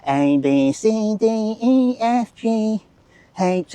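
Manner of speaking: high sing-song alphabet chant; mock child chanting letters, pitched high; imitating the kid. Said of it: "their kid was doing the A B C".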